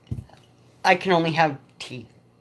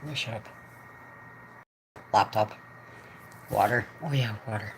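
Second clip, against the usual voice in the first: muttered aside; 8 semitones lower, 11 dB quieter than usual. Real, not acted.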